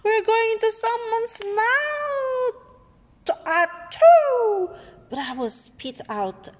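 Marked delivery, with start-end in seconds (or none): 0.0-2.6 high squeaky alarm; panicked. Acted. Seeds panic heading into a mouth, high squeal. 3.4-4.8 high loud fake sneeze. Giant sneezes the seed out, high and loud.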